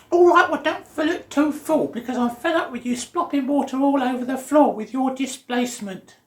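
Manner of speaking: shrill high, loud mock mother; nagging mum. Mum telling Archie off about splashing water, high loud mock scold. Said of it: "And she said something like".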